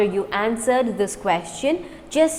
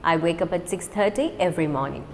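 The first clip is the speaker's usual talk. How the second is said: quick, run together; fast; 3 semitones lower, faster than usual. Shown as a demonstration. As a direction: rattle it off at natural speed. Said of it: "Now let's do it fast".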